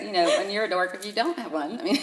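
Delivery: ordinary delivery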